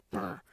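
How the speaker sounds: low grumbling brr under the breath; grumpy mutter. Retold or imitated. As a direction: grumble it like a surly old man. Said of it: "muttering under his breath".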